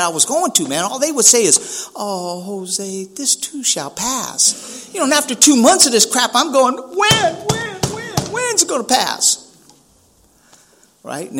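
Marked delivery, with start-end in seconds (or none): 1.9-4.9 drawn-out, soft mock consolation; mocking platitude. Mimics program members' stock reply. 6.8-9.4 high-pitched mock whine; hammed-up impatience, voice shooting way up; exasperated pleading. Acts his impatient reaction to platitude.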